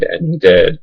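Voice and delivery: ordinary talk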